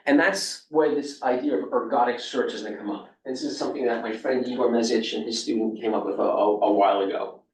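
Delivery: ordinary talk, nothing marked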